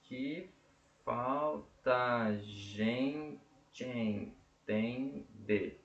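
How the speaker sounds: muito lento, sílaba por sílaba; escansão silábica. Shown as a demonstration. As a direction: separe cada sílaba devagar. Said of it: "Um, dois". (escandindo o verso devagar)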